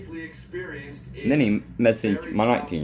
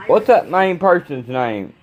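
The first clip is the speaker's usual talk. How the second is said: raised voice, calling across the room; 7 semitones higher than usual. Real, not acted. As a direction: call out to someone in the next room. Calling out to someone off-camera.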